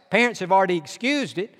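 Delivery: usual speaking voice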